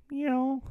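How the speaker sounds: high playful cat meow. Pretend. To viewers, joking about money in the kitty, a high meow.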